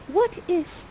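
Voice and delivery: put-on high, soft voice of an awestruck young heroine; hushed, high-pitched marvelling; Miranda. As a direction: wonder aloud in lifted, theatrical verse. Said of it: "Okay, and you say".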